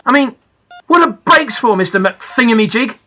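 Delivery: bitter, sarcastic outburst, pitched high; angry, churning inside. A narrator's reading. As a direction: let the indignation boil over. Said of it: "I'm so angry. I'm churning up inside".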